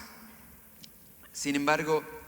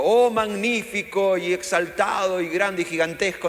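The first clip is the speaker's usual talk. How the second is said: much higher, loud, hammed-up exalted praise; grandiose prayer; 9 semitones higher, 9 dB louder than usual. Pastor mocking inflated prayer language, hammed-up grandeur. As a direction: overblown worshipful mock prayer.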